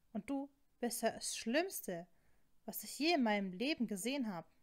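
heated, emphatic reproach; worked-up accusing outburst; indignant accusation. Acted. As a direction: confront a cheating partner, stressing the key words. Acted confrontation over a kiss.

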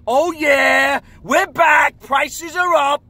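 high, loud, drawn-out crowing; playfully acted triumphant holler; mock boastful shout. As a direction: holler it back like a taunt. Acts out their own cocky reply.

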